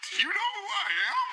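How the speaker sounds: re-enacted very high shriek; hysterical fan freak-out. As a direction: squeal in mock disbelief. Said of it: "lost it".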